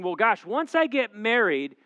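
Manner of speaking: mock naive guy. Pastor mocking single men who expect marriage to fix everything. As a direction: ham up the wishful thinking.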